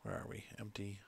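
whispering. Real, not acted. Quiet aside while loading.

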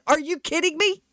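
high-pitched, indignant question; retold pissed-off disbelief. Traveler told of bomb residue on a shoe, retold irritation. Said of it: "I was getting pissed".

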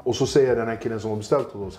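ordinary talk

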